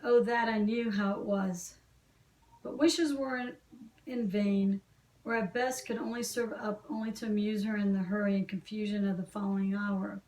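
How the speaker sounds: ordinary delivery, nothing marked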